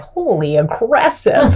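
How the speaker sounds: taken aback. Retold or imitated. Spouse reacting to her driving, mock shock. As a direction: stretch it out with a laugh in it. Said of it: "you're like".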